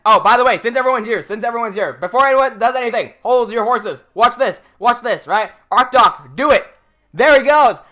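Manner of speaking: loud, eager streamer call-out; rally the viewers, loud and keen; thrilled to show a viewer liking the video, loud; excited hype. Real, not acted. Hyping a live like on stream.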